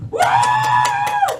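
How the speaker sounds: sudden very high whooping cheer; clap cheer shout. Shown as a demonstration. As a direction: whoop like the crowd should. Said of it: "ang tamang palakpak, ganito".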